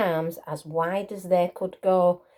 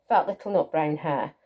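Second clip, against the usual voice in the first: plain reading voice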